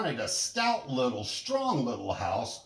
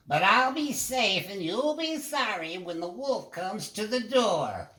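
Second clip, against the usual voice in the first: higher voice, stern little pig warning; warning call; 8 semitones higher than usual. Acted. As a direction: warn them they'll be sorry. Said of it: "their busy brother called after them".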